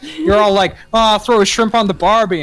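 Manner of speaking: mock rude waiter. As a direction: sneer at the New Zealanders. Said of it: "he's like".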